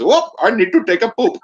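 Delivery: very high, silly put-on voice; squeaky mock villager. Mocking a villager waking up, in a very high silly voice. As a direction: squeak it out like a cartoon yokel. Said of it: "he goes".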